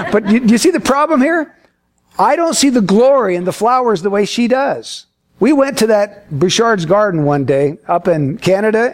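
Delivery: ordinary talk, nothing marked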